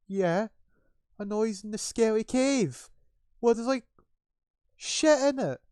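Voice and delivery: high, loud panicky guard voice; frightened guard. Acted. Frightened guard argues with the other about the cave, panicky. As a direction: protest in a high, drawn-out yell.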